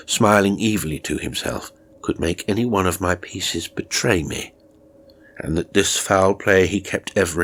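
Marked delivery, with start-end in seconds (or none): none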